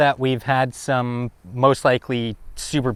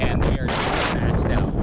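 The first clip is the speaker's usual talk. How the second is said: blown-out wind noise. Real, not acted. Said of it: "probably can't even really hear or understand".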